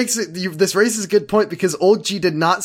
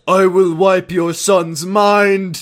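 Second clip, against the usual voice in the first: villain voice; 5 semitones higher, 4 dB louder, much slower than usual. Retold or imitated. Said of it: "'cause Kyle was like".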